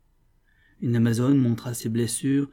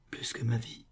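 soft, tender whisper; whispered declaration; 7 dB quieter than usual. A narrator's reading. The officer answers that he loves her, whispering. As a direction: breathe it softly to a lover.

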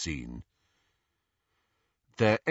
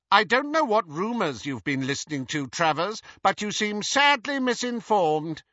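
haughty Bellatrix; 10 semitones higher, 3 dB louder than usual. Acted. Bellatrix rebuffs Travers.

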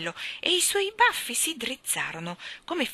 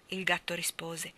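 ordinary delivery, nothing marked

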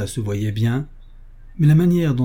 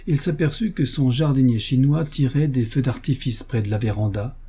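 usual speaking voice

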